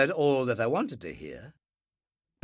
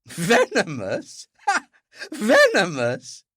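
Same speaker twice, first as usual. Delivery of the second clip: high, slow laugh through the word; cracked chilling laugh; 7 semitones higher, 5 dB louder, much slower than usual. Acted. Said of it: "She laughed, a cracked and chilling sound".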